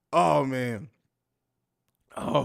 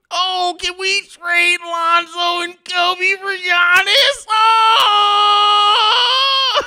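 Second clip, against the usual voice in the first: high, loud, drawn-out wailing fan; annoying Twitter fan; 12 semitones higher, 8 dB louder, much slower than usual. Acted. Annoying Bulls fan begging for a trade, mock wailing. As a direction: whine it slowly, building to a long cried-out shout. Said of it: "that is the voice of the, of the annoying Twitter Bulls fans".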